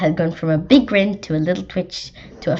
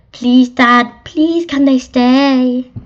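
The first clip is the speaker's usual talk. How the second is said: drawn-out, higher, louder plea; pleading child; 6 semitones higher, 6 dB louder, much slower than usual. Acted. Child begging Dad to let the rats stay, pleading. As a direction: beg Dad, stretching the words out.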